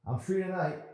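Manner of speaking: high, loud, blurted fast; nervous boy blurting too quickly; rehearsed, rapid. Acted. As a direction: rattle it off like a rehearsed line. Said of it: "he said rapidly enough for it to sound rehearsed".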